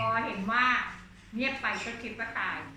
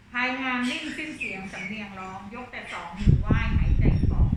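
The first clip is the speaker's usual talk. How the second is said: poem recital; 8 dB louder than usual. Acted. Teacher reads verse.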